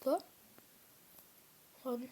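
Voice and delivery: ordinary talk, nothing marked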